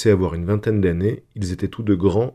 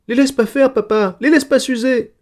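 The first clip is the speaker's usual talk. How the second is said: put-on very high, loud wail of a little girl; child howling; 5 dB louder than usual. A narrator's reading. Frightened daughter begging papa to save the stars, howling. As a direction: wail it in a shrieky small child's voice. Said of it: "se mit aussitôt à hurler".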